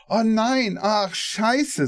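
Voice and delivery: put-on old lady swearing. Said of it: "sagt die".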